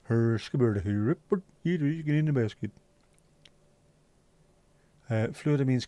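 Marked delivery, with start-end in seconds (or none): 0.0-2.7 a hint of the Swedish chef, mock Swedish; light fake Swedish gibberish. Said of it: "The Swedish chef".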